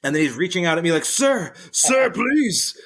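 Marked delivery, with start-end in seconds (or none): 1.0-2.8 high, strained imitation of a fallen old man begging; old man pleading. Fallen old man reaches out to the shopper, high and pleading. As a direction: beg for help from the floor, much higher.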